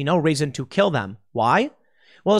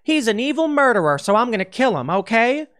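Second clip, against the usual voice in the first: mock politician voice; 10 semitones higher, 4 dB louder than usual. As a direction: ham it up as a scheming prosecutor. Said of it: "Kamala Harris walks up to you and says".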